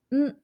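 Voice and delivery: ん hummed short through the nose; a clear, humming ん; nasal n demo. Shown as a demonstration. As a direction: model sound ん. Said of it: "独特な発音として".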